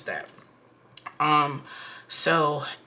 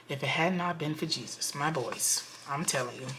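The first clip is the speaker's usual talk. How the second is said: hushed insistence; 7 dB quieter than usual. Real, not acted. Vlogger confiding to viewers after holding back, hushed insistence. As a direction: drop the volume and mean it. Repeats vow under her breath.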